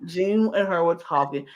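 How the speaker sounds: normal talking voice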